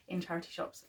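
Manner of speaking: normal talking voice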